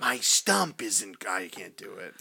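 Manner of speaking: a slow, low, lightly done killer doll growl; a half-hearted try at Chucky, slowed down. Pretend. Asked for a Chucky voice, tries it slow and low. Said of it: "Can you say that in a Chucky voice?".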